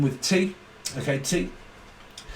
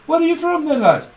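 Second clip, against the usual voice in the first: much higher, louder Birmingham lilt; Brummie accent; 12 semitones higher, 6 dB louder than usual. Retold or imitated. Brummie quizzes a lad, high mock Brummie accent. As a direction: sing it out in a hammed-up Brummie accent. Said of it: "it's Brummy".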